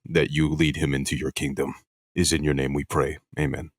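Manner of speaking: mock prayer. Host leading a joke prayer for the podcast, solemn and hushed. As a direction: lead the group in a hushed, earnest prayer. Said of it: "let's pray over his spirit".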